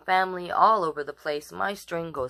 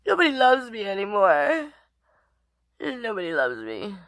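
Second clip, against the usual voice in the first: hammed-up feeling-sorry-for-myself; mock lonely whine; 3 semitones higher than usual. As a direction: whine it like a sad puppy. Host jokes no kids named.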